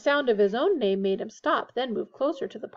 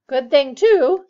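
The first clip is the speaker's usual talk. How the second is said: loud, higher, businesslike remark; brisk; 4 semitones higher, 12 dB louder, slower than usual. A narrator's reading. Missus Weasley comments on the cars, brisk. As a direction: come in firmly and briskly. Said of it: "said Missus Weasley briskly".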